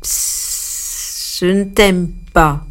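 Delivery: a hiss, then a lightly voiced sneering snake. A narrator's reading. Snake sneers at the hippo with a hiss. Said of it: "il persifla".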